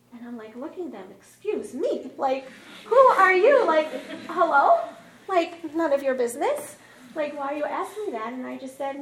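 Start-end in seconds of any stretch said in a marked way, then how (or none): 1.1-8.3 loud, high, affronted disbelief; indignant. Retold or imitated. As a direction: demand who they think they are. Affronted by question about pregnancy.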